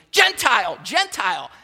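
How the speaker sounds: high, loud mock yelling of the word; imitating a woman yelling at a stranger; retold yell. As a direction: shout it like an angry neighbour from the street. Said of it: "this woman starts yelling at me".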